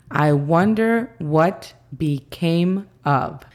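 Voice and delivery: slow, careful English demo; teacher modelling slowly; slow model phrase. Shown as a demonstration. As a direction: spell out each word slowly. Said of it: "I M. Became. Of sería A V".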